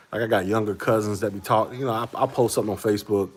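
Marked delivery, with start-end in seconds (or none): none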